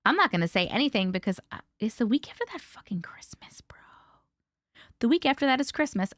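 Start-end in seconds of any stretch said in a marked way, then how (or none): 1.5-3.8 hushed aside; joking whisper. Acted. Host whispers to viewers about Christmas week, joking whisper. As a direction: whisper it like a secret to chat.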